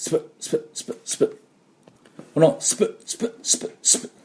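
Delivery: スプ、スプ breathed out with no voice; voiceless スプ repeated as a drill; unvoiced spu drill. Shown as a demonstration. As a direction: whisper the スプ sounds like puffs of air. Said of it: "と音を出せないと".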